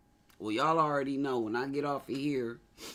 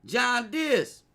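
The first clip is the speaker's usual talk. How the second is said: John Dis with a Brooklyn twang, much higher and louder; hammed-up New York accent; East Coast accent; 10 semitones higher, 7 dB louder than usual. Shown as a demonstration. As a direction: call it out like a Brooklyn guy. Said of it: "that was that East Coast shit".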